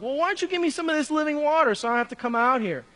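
high, fast, cheeky retort; witty snap-back. Retold or imitated. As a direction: act out a quick witty comeback. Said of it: "real witty responses".

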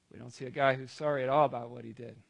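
ordinary delivery

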